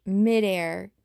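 usual speaking voice

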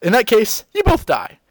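hammed-up villain king; cold king. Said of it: "he said".

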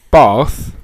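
"bath" drawn out as "bahth"; long-vowel "bath". Shown as a demonstration. Comparing how they say words, long-vowel "bath". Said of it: "if you say stuff like".

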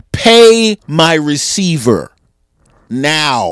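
mock quarterback making a pointed demand; slow demand. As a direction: lean into the mic and demand it. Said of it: "at a press conference".

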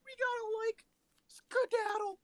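very high, cracking cartoon voice; Shaggy. Cartoon pal urging an escape, high squeaky impression. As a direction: squeak it high, halting between phrases. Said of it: "halfway do is Shaggy".

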